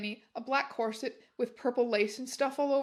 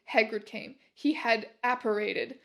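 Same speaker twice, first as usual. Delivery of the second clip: plain reading voice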